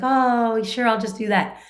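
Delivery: a light sing-song of instant compliance; mock eager son. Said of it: "my son's just gonna be like".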